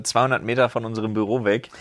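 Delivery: ordinary delivery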